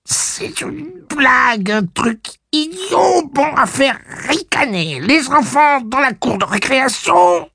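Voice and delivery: put-on high, slow breaking teen voice; a fourteen-year-old mid voice change. A narrator's reading. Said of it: "celle d'un gamin de quatorze ans en pleine mue".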